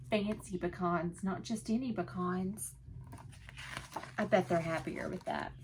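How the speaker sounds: usual speaking voice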